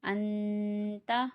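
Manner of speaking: अंता slow, the न hummed through the nose before ता; ghunna on the noon. Shown as a demonstration. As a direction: hold a long nasal n in अंता. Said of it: "नून साकिन पे गुन्ना होगा".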